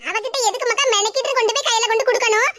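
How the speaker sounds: normal talking voice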